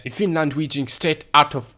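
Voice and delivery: badly faked Finnish accent. Gamer asking viewers to bear with him, bad Finnish accent. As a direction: put on a rough Finnish accent. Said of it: "that was a bad Finnish accent".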